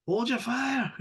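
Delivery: high-pitched mock call to comrades; put-on high holler, held back. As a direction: call out high without real volume. Acted calling out to armed comrades.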